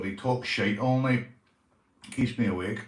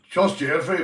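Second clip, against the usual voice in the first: puppet voice; 6 semitones higher, 7 dB louder than usual. Acted. Puppet line.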